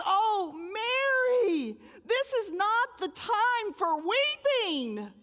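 high, slow, tender reproach; loving angel voice, drawn out; gentle angel chiding. Acted. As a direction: coax her out of her grief.

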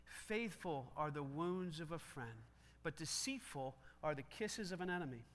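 slow, measured scripture reading aloud; slow recitation. Shown as a demonstration. Pastor leading the church through the verse, slow reading. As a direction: read the verse slowly with the crowd. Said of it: "Can you say that out loud with me?".

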